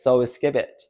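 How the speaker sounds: usual speaking voice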